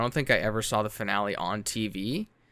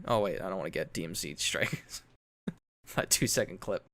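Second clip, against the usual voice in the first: usual speaking voice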